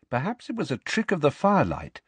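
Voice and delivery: ordinary delivery, nothing marked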